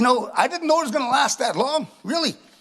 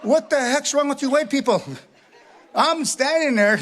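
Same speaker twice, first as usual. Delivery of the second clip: ordinary talk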